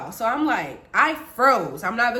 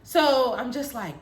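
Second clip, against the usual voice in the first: plain reading voice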